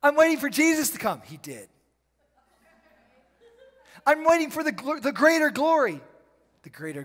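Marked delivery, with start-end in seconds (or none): 0.0-1.2 loud, high, fast mock of a lazy believer; mocking quote. Mocking a passive believer's excuse. 4.1-6.0 loud, high, fast mock of a lazy believer; mocking quote. Mocking a passive believer's excuse.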